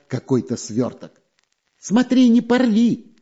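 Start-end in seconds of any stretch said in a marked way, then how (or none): 1.8-3.2 high, anxious warning. A narrator's reading. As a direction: warn him not to tear it. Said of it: "предостерёг его шпион Дырка".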